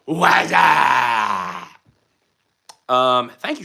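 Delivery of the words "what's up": shouted greeting. Acted. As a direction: call it out like a hype man.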